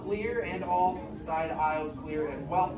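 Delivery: brisk theme park safety announcement; announcer spiel. Acted. Cast member to audience, reading the safety rules. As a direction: run through the house rules for the crowd.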